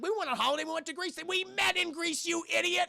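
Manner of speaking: mock annoyed boyfriend calling her an idiot; exasperated. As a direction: point out the obvious to her. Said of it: "Sean brings it up".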